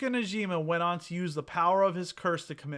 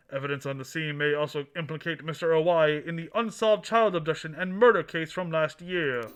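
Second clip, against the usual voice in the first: booming newsreader delivery; news announcer voice; 3 semitones higher, 7 dB louder than usual. Pretend. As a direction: read it like a TV anchor. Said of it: "put my announcer voice on".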